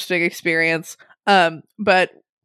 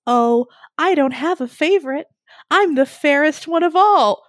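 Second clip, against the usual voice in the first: high, lilting vain princess voice; haughty princess; 6 semitones higher than usual. Retold or imitated. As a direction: preen like the fairest of them all.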